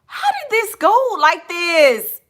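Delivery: high, loud, incredulous wail; exasperated outcry. Real, not acted. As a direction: cry out in disbelief at the chat. Exasperated at where chat went.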